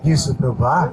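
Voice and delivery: preacher leading prayer aloud, phrase by phrase; fervent prayer. Acted. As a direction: pour it out to God with the church. Leads congregation in prayer. (ప్రార్థనలో ఉత్సాహంగా)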